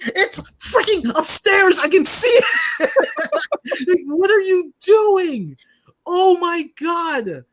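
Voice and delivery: high, slow, exasperated near-laughing outburst; real frustration. Real, not acted. Fan venting about constant game interruptions, exasperated. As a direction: let the anger crack the voice up high. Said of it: "You can hear it in my voice".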